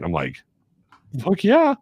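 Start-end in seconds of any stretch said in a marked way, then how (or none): 1.2-1.8 much higher, louder, rising whoop; mock-thrilled yes to the big invitation; eager yes. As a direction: blurt it out thrilled. Eagerly accepting the night out.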